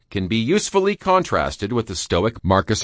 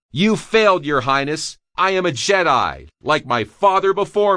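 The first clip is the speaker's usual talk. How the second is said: put-on higher, proud, defiant young hero; defiant Luke; 8 semitones higher than usual. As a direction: stand up to him, high and firm. Luke defying Emperor.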